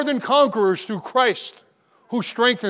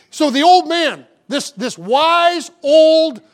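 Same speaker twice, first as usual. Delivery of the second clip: fervent sermon delivery, raised voice; preaching; 5 semitones higher than usual. Acted. Pastor urging the congregation, preaching fervently. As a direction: preach it to the flock with conviction.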